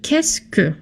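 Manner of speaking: "qu'est-ce que" run together as one word, kess-kuh. Shown as a demonstration. Teacher modelling "qu'est-ce que" as one word. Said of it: "they are pronounced".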